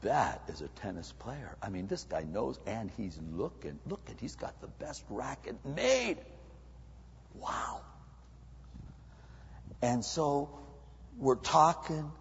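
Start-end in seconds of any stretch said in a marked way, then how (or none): 0.0-7.8 soft, hushed admiration; awed onlooker. Acted. Onlooker admiring the player's gear in hushed tones. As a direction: murmur it in quiet awe. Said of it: "you go".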